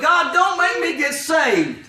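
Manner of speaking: high, drawn-out plea; retold plea, stretched out and pitched high; pleading. Sinner begging God not to save him, pleading.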